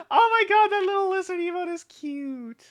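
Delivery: gush, loud and high, about something adorable; aww-struck excited squeak; delighted squeal. Real, not acted. Squealing at a cute emote.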